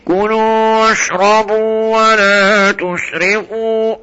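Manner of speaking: mock nasal reading of كلوا واشربوا ولا تسرفوا; nasal mispronunciation. Shown as a demonstration. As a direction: read it slowly with a forced nasal ghunna. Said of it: "طلعها من الخشومة".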